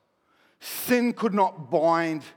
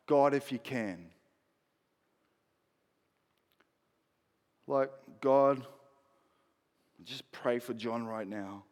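begging prayer; 4 semitones lower, 5 dB quieter than usual. Retold or imitated. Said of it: "it's like almost a beg".

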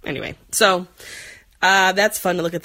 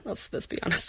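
breathy confessional whisper; whispered aside; 11 dB quieter than usual. Real, not acted. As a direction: drop to a whisper, admitting it.

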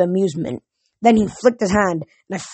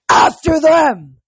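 drawn-out, high, loud roar; furious bellow; 8 semitones higher, 7 dB louder, much slower than usual. A narrator's reading. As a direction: bellow the command to your army. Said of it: "the loudest bellow in the world".

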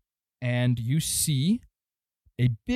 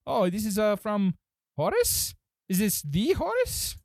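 high-pitched, hesitant gnome voice; surprised gnome clerk; 8 semitones higher than usual. Acted. Gnome reading a letter from Horace, high and surprised. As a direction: climb high in halting disbelief. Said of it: "He takes it and he says".

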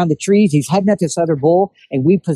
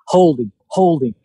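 ordinary talk

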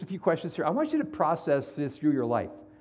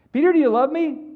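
higher, louder, more insistent; Jesus asking again; 12 semitones higher, 8 dB louder than usual. Retold or imitated. Jesus repeats to Peter, higher and louder. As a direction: push harder this time.

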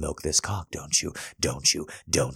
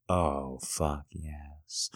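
ordinary delivery

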